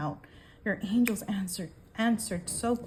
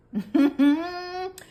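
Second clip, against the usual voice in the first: high, loud closed laugh; gleeful laugh; 10 semitones higher, 7 dB louder than usual. Real, not acted. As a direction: laugh with relish at the card. Delighted by the karma card.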